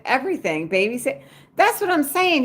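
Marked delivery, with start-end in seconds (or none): 1.6-2.4 excited emphasis. Real, not acted. To viewers about side hustles, excited shout of agreement. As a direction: jump way up high and loud on it.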